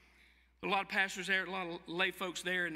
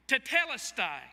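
raised, ringing cry of triumph; cried out; 6 semitones higher, 5 dB louder than usual. Acted. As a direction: call it out loud across the hill. Preacher voicing Jesus crying out.

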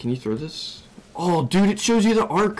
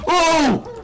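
startled cry; 12 semitones higher, 4 dB louder than usual. Real, not acted. Sudden attack.

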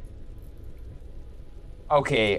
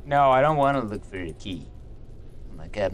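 usual speaking voice

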